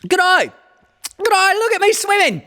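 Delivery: very high, loud squeaky little joey with Australian twang; squeaky Roo. A narrator's reading. Roo squeaks proudly to everyone from the pool, very high. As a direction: squeak it out like an excited little kangaroo. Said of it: "squeaked Roo".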